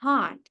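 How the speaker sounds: "Hot" with a relaxed, almost a-like O; soft English O. Shown as a demonstration. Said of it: "Parece até um pouquinho de A".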